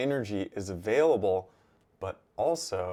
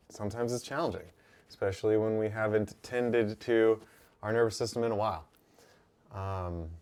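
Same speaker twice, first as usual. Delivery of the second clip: ordinary talk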